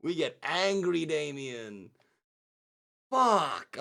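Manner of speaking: loud furious burst; angry. Acted. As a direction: let the anger explode. Player voicing Damien's rage.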